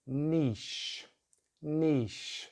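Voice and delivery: "niche" as neesh; British pronunciation. Shown as a demonstration. Teacher models British English, "niche" said neesh. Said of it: "It's a nice long vowel".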